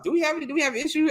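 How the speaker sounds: quick, high mock flight attendant. Mocking the flight attendant stepping in, high and quick.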